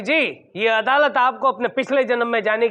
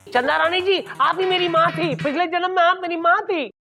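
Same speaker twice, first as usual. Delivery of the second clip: dramatic filmy revelation; 4 semitones higher than usual. Acted. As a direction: announce it like a soap opera twist. Dramatic past-life revelation. (फ़िल्मी नाटकीय अंदाज़)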